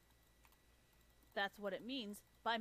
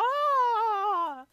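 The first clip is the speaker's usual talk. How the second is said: long, high, full-volume mock scream; piercing drawn-out wail; cracked-out scream; 14 semitones higher, 18 dB louder than usual. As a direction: scream hysterically, hammed up. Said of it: "methed out or cracked out".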